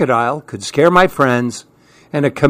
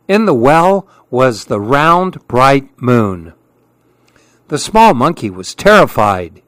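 plain reading voice

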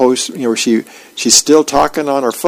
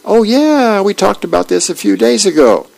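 breezy suggested line; 8 semitones higher than usual. Retold or imitated. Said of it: "say something like".

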